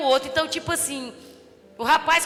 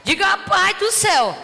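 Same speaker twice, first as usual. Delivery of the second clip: loud, high, fervent preaching; rising revival sermon; preaching at full force; 5 semitones higher, 6 dB louder than usual. Acted. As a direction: preach it out to the whole church.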